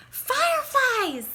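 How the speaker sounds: high, drawn-out joyful shout; excited call. A narrator's reading. Child calling friends in the street, high and drawn out.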